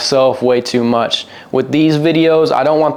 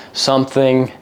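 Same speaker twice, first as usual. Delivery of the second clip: ordinary delivery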